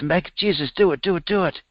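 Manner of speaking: a man pleading with Jesus to raise the bones. A narrator's reading. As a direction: beg him to do it, over and over. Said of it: "just pleads".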